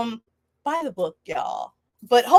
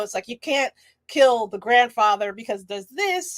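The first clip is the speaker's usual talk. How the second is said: ordinary talk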